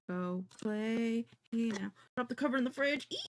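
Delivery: ordinary delivery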